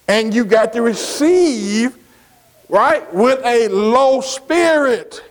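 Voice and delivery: drawn out, slow preaching; slow, deliberate sermon delivery; slowed right down. Shown as a demonstration. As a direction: stretch every word to let it sink in. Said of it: "let me slow it down a little bit".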